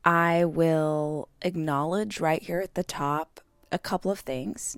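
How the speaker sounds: ordinary talk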